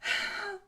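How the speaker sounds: big, deep, theatrical sigh; hammed-up deep sigh; overdramatic sigh. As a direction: heave a put-upon sigh. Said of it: "deep, overdramatic sigh".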